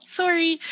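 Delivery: high-pitched "sorry" with a round SORE vowel; Canadian "sorry". Shown as a demonstration. Actor demonstrating for the host, high "sorry" as SORE-ee. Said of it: "Canadians have that".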